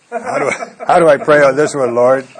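high laugh breaking into a loud, puzzled plea; laughing bewilderment. Retold or imitated. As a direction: laugh helplessly at an odd prayer request. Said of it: "I go".

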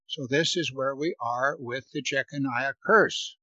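ordinary talk, nothing marked

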